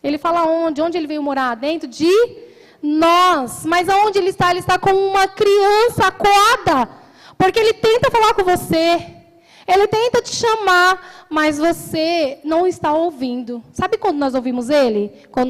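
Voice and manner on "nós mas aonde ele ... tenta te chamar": louder, higher preaching swell; impassioned pulpit plea; fervent preaching. Acted. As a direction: press the point with urgency.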